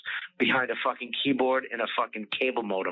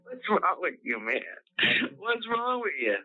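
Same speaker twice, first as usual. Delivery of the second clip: much higher, incredulous; exasperated; 8 semitones higher than usual. Real, not acted. Exasperated comic asking his co-host what's wrong with him. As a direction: ask it like he's lost his mind.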